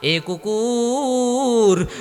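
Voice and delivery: high, very long-drawn chanted call; angry master. Acted. Angry master to his dog, high drawn-out call. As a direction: drag out the scolding call with a falling end. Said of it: "মালিক রাগান্বিত হয়ে বলে".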